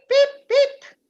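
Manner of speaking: put-on high, loud peep peep like a baby chick; playful high chick call. Shown as a demonstration. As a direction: squeak it out like a tiny chick. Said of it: "But in English, it's peep".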